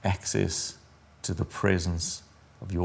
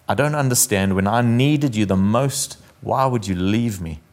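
loud, earnest sermon reading; poem reading; 4 dB louder than usual. Acted. Pastor reading the Footprints poem before communion, declaimed. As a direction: read it out to the congregation with feeling.

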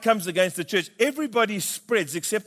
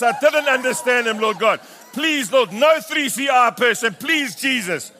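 panicked prayer; 3 semitones higher, 3 dB louder than usual. Retold or imitated. Pastor praying no church member sees him, frantic. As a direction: beg God in comic panic.

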